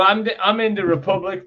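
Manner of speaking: normal talking voice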